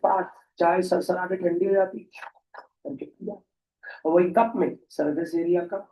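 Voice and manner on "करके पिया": whispered sipping sound; whispered slurp. Retold or imitated. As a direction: breathe it out like slurping tea. Mimics slurping tea. (फुसफुसाकर सुड़कना)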